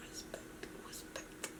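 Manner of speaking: whispered mock repeat. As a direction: hiss the word back mockingly. Mocking his word to herself.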